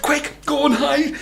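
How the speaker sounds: put-on high, quick hurry-up to the boy; urgent warning. A narrator's reading. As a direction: rush him into hiding. Wife urges Jack.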